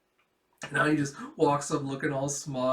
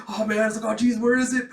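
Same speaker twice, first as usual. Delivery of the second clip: mock-panicked, higher and louder; flustered; 8 semitones higher, 7 dB louder, faster than usual. Flunky frantically searching for a handkerchief, mock-flustered. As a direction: fret loudly in a raised voice.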